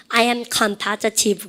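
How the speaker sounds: very slow, each word drawn out; slow answer for students to copy; slow drill. Shown as a demonstration. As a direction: model it slowly for the class. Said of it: "시작".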